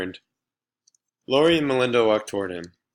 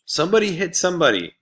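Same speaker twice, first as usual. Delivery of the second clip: much higher, louder, fast egging on; shout; 9 semitones higher, 6 dB louder, faster than usual. Acted. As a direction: egg the fighters on. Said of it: "shouted Laurie".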